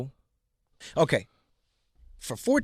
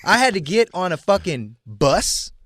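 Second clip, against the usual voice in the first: emphatic rant; 5 semitones higher, 5 dB louder than usual. Real, not acted. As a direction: hammer out each hardship. Emphatic rant about old days.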